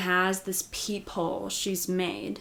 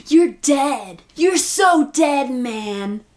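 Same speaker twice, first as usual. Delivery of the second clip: loud, high mock-angry shout; hammed-up shouted threat; Bobby yelling threats; 5 semitones higher, 9 dB louder, much slower than usual. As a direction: yell a threat across a crowd, imitating. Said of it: "Bobby is yelling".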